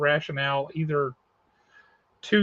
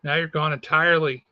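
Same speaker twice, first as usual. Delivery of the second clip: ordinary talk, nothing marked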